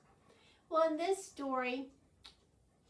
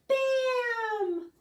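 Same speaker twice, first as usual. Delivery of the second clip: very high, loud bam; shrieked sound-effect bam; shouted bam; 12 semitones higher, 11 dB louder than usual. Acted. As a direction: burst out like a popping pan. Rhyme punchline sound.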